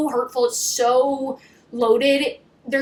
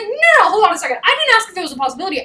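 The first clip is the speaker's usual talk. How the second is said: loud, higher rapid-fire objection; mock exasperation; 6 semitones higher, 5 dB louder, much faster than usual. Him not letting her dodge the question, mock exasperated. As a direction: cut in and correct her. Acts out his exasperated correction.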